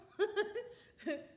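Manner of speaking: high, loud amused laugh; burst of laughter. Real, not acted. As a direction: laugh out at the impossible maze. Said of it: "it does not seem, uh, possible".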